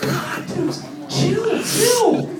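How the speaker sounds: high incredulous chill dude. Retold or imitated. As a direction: tell the animal to calm down. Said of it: "the whole time I'm like".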